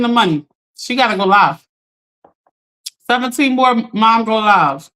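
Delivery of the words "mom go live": ordinary delivery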